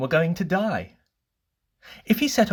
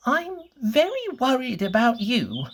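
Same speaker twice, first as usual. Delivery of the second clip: much higher, anxious fretting little voice; Mister Worry's voice; 11 semitones higher than usual. Acted. Mister Worry frets to Mister Bump in a high, anxious voice. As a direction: fret over him in a high, worried voice. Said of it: "he said".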